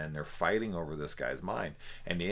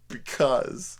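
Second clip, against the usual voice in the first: smug villain; 9 semitones higher, 9 dB louder than usual. Retold or imitated. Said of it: "And he says".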